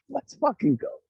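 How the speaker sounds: high-pitched, squealed hype cheer; retold hyped cheer. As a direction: relive the celebration, voice leaping way up high. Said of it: "And I'm like".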